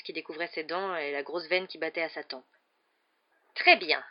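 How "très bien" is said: loud, curt concession. A narrator's reading. Uncle finally giving in to his nephew's bargain, curt. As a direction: agree grudgingly, in a dry tone. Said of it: "dit-il enfin d'un ton sec".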